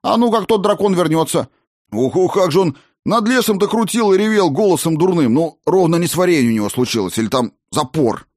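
high, fast-talking gnome; excitable gnome, carried away; chatty gnome. Acted.

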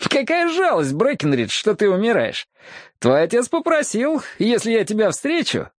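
high-pitched, gloating rival's voice; Джим Брэкстон. Acted. Rival chats to dying Брэкенридж, high gleeful voice. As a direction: pitch it high, barely hiding delight. Delighted rival's speech acted out.

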